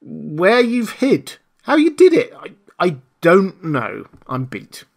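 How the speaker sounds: high, slow, drawn-out bafflement; baffled exasperation. A narrator's reading. Marvel to the hidden man, baffled and beaten. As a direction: wail the questions, then sink into defeat.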